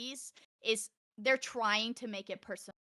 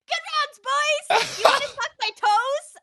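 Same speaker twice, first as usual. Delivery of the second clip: put-on very high, loud squeaky gamer-girl call; high mock yell of a cheeky teammate; mocking Yara; 10 dB louder than usual. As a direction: shriek it out like the friend shouting into voice chat. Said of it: "Yara immediately goes".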